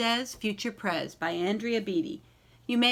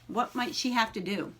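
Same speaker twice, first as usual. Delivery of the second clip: ordinary delivery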